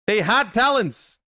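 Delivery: loud, high outburst of disbelief; excited shout. Real, not acted. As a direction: yell it out, shocked and thrilled.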